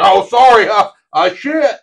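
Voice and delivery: loud, high mock sorry; mock apology. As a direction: ham up a guilty apology. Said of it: "He's like".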